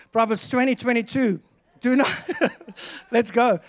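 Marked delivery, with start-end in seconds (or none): none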